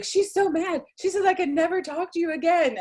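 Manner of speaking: high-pitched, frantic retold protest; hammed-up upset report to friends; mock distraught complaint. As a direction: wail it in exaggerated distress. Upset, accusing the laughing friends.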